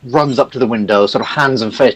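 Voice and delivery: ordinary delivery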